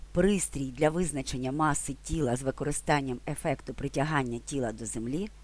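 ordinary talk, nothing marked

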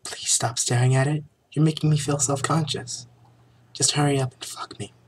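high, flustered heroine; embarrassed hammy plea; hammy stage actor. Acted. Vanessa's dialogue, high.

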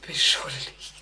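whispered admission; fading whisper. Acted. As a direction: let it die away. Confession fading.

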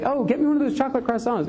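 wife over video chat, excited and quick; wife's request. Retold or imitated. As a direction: chirp it like a craving wife. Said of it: "she's like".

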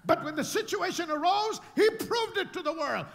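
loud, high preaching shout. Acted. Preacher shouting to the congregation about the proof. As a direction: drive it home to the crowd.

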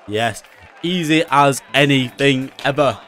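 ordinary delivery

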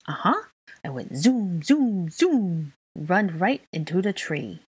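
put-on playful kid voice with drawn-out zooms; gleeful little child. A narrator's reading. Child describing flying into a tree, gleeful. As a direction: tot acting out the crash with delight. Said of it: "he laughed".

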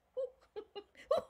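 very high, loud helpless laughter; ticklish feather laugh. Acted. Laughter teacher leading the ticklish feather laugh exercise. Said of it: "Start by imagining yourself".

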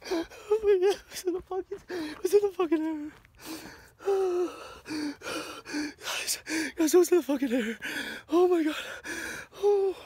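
shaken, can't believe what happened; panicked. Real, not acted. Saw doll floating, panicking.

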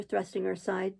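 plain reading voice

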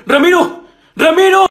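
loud, high yell of a name; shouting to wake someone. Acted. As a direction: yell to jolt a sleeper awake. Teacher shouts to wake pupil.

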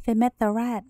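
ordinary delivery

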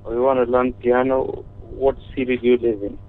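usual speaking voice

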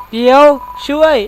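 high, loud frantic plea; desperate cry for help. Acted. Trapped friend begging the player for rescue, frantic.

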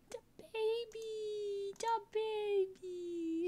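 high, slow squeal of delight; adoring squeal. Real, not acted. Squealing over a newborn puppy.

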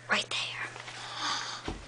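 soft breathy repeat; whispering. Real, not acted. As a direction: whisper it close to the mic.

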